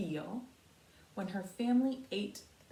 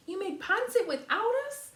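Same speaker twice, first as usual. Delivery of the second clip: high, loud indignant holler; hollering brother; 10 semitones higher, 7 dB louder than usual. Acted. Brother protesting at the dinner table, high loud holler. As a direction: call it out like a brother left out. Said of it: "hollered Cora's brother Crispin".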